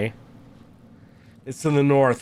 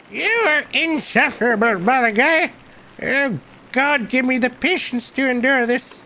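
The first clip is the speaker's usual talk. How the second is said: put-on high-pitched old man at wit's end; exasperated old monk; 10 semitones higher than usual. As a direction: complain in a high old man's voice.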